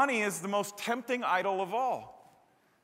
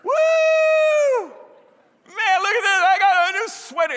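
much higher, louder, hammed-up whoop of delight; over-the-top excitement; 9 dB louder than usual. Pastor mocks a shopper thrilled by a new sweater, hammed-up excited whoop. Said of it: "He's like".

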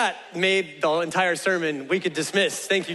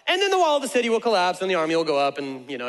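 normal talking voice

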